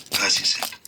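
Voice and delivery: usual speaking voice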